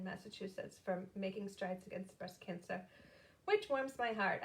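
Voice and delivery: ordinary delivery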